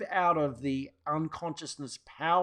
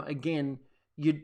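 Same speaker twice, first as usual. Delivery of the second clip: ordinary delivery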